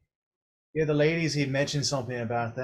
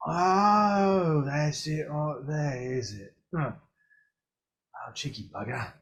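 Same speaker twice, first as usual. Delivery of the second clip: Mor'du's voice. Acted. Mor'du finding the answer inside the hero, then muttering. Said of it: "and he goes".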